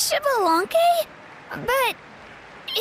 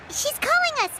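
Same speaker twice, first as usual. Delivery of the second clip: normal talking voice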